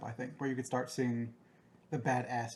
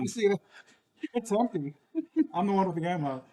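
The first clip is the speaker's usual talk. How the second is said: character voice; 6 semitones higher, 6 dB louder than usual. Pretend. Mock reply in character, raised voice.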